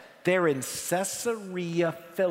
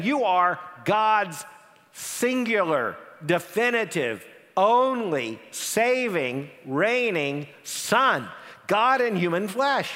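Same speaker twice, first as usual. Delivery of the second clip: slow, each title stressed and spaced; emphatic build; 3 semitones higher, slower than usual. Acted. Preacher spelling out what Peter meant, slow and emphatic. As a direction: hammer home every word.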